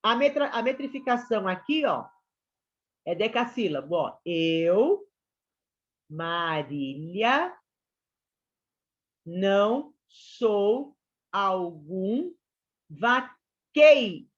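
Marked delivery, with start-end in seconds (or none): 4.2-14.3 each syllable drawn out slowly; slow scansion reading. Shown as a demonstration. Teacher reads the verse slowly for students. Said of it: "A última sílaba aqui, ela não é contada".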